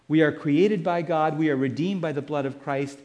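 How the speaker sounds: ordinary talk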